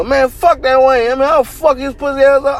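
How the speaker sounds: high-pitched mock rant; mocking angry heckler.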